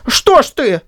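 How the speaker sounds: high, pained, timid question; hurt and timid. A narrator's reading. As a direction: wince it out, vexed. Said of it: "таким голосом, в котором изображалась и боль, и досада, и робость".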